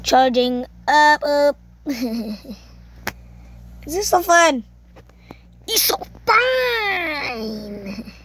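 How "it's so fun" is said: FUN stretched very long, sliding down; drawn-out FUN. Real, not acted. Player enjoys the game, FUN hugely drawn out. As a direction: hold FUN in one long falling cry.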